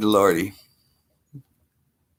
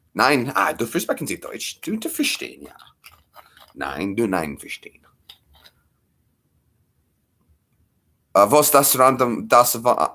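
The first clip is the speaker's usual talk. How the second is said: put-on German accent, halting; mock German with broken phrases; playful faux-German accent; 2 semitones higher than usual. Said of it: "doing our German accent".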